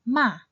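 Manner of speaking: MA starting high and dropping sharply; short falling MA, as in frustration; fourth tone. Shown as a demonstration. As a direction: say MA with a quick downward fall. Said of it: "when read in the fourth tone".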